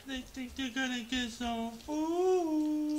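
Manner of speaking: high, loud, slow pet voice. Real, not acted. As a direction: coo at the excited puppy. Baby talk to the dog.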